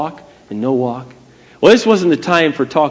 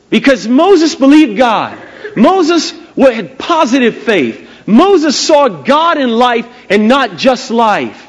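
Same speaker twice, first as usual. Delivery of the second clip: high, forceful sermon declaration; emphatic preaching; 8 semitones higher, 4 dB louder, slower than usual. Real, not acted. Preacher proclaims Moses' faith to congregation, preaching emphatically. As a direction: drive each phrase home, higher and slower.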